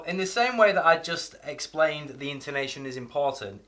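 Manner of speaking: normal talking voice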